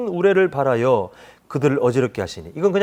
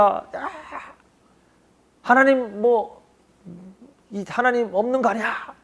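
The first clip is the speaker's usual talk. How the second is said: complaining; 4 semitones higher than usual. Retold or imitated. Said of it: "이렇게 불평할".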